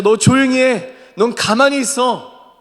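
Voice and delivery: high, loud order to hush; scolding bystanders. Acted. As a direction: silence him forcefully. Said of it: "주변에서".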